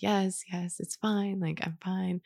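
very soft, fragile insistence; hushed denial while crying in the bathroom; tearful reassurance. Retold or imitated. Insisting she's fine while sobbing.

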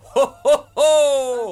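loud high rolling Santa laugh; the right way to say ho ho ho; proper jolly ho ho ho. Shown as a demonstration. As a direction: roll out a warm belly laugh. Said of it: "It's a ho".

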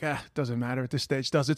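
a soft, shrugging mum brushing it off; offhand mother. Retold or imitated.

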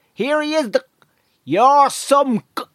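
high-pitched Navan greeting; Navan accent. Acted. Navan local greeting someone fondly, high broad Navan accent. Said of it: "if I was in Navan".

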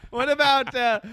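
usual speaking voice

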